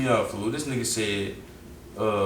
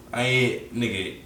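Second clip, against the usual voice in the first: ordinary talk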